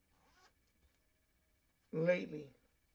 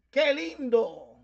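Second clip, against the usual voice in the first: "qué lindo" said slowly, pitch up on "qué" and a bit louder; emphatic qué lindo; 5 semitones higher, 6 dB louder, much slower than usual. Shown as a demonstration. Explaining Spanish emphasis to a listener, slow stressed qué lindo. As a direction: lean on "qué lindo" like an exclamation, drawn out. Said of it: "you're putting emphasis on the word lindo".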